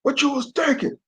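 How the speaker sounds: a hint of a stern father; imagined scolding father. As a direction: chew the son out in a mock father voice. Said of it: "Tom Hanks probably chewed him out".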